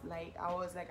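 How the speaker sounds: ordinary delivery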